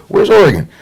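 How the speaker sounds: high, loud baffled question; clueless classmate. Retold or imitated. Southern classmates asking about Oregon, high mock bafflement. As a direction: mimic someone who has never heard of the place.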